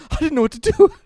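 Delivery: put-on flustered kid. Tackler explains himself.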